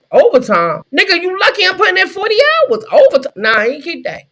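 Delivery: loud, high-pitched disbelief; indignant refusal. Acted. Worker refusing overtime beyond forty hours, indignant.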